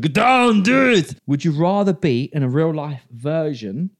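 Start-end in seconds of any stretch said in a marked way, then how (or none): 0.0-1.2 shouted, higher Arnold bark; Schwarzenegger impression. Host quoting Arnold in Total Recall, loud and higher. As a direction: yell it like Arnold in an action scene. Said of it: "And Arnold Schwarzenegger".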